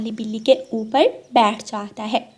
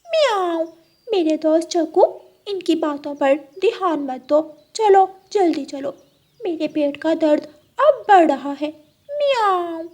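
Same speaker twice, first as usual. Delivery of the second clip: urging cat; 6 semitones higher than usual. Acted. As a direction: hurry your friend along as a cat. Cat hurries the crow away.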